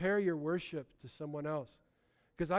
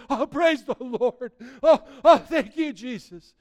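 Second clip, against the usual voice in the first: imitating joyful praise, laughing, loud and slow; a hammed-up worshipper laughing with joy; 7 semitones higher, 14 dB louder, slower than usual. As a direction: laugh out your thanks like a delighted believer. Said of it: "they're like".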